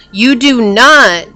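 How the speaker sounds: NOT slow and loud, falling; stress on NOT. Real, not acted. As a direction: lean hard on "not", slowly. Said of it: "and I repeat".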